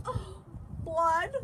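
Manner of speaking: high-pitched yelp from a mother; yelled. A narrator's reading. Mother sees her son's finger, high yell. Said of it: "Loretta's mother yelled".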